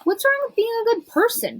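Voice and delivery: very high, incredulous query; indignant question. A narrator's reading. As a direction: challenge it in a high-pitched voice.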